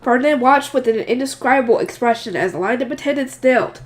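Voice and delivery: plain reading voice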